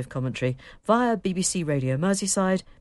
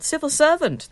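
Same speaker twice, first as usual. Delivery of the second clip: voice going up; 13 semitones higher, 9 dB louder than usual. Real, not acted. Presenter reading out news of a commentator, voice going up. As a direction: voice climbing higher and louder. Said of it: "My voice went up there".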